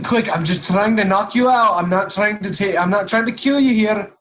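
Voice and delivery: usual speaking voice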